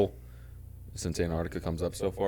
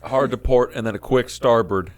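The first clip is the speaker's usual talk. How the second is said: louder, barked helm orders; captain's orders; 6 semitones higher, 10 dB louder than usual. Pretend. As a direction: call the turn like a captain.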